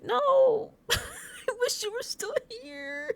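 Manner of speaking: high, drawn-out whine; hammed-up weepy plea; tearful wail. Real, not acted. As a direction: wail it way up high, stretching the last word. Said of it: "I'm tearing up right now".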